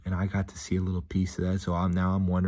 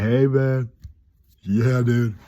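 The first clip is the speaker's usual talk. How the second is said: loud, higher imitated gym greeting; hearty greeting; 4 semitones higher, 7 dB louder, much slower than usual. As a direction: hail a pal loudly. Acts gym people greeting him.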